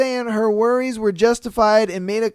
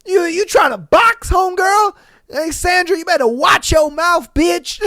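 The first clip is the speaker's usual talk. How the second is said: loud, high, hammed-up challenge; playful tough-girl call-out, loud and high; mock fighting words; 9 semitones higher, 6 dB louder than usual. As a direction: square up to her like a street fight. Mock confronting Sandra, hyped up.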